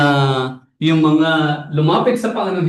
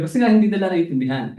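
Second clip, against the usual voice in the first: normal talking voice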